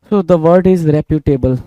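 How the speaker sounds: very slow and loud, word by word; slow dictation. Shown as a demonstration. Teacher dictating the word to students, very slow and loud. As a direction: spell the new word out slowly for the class.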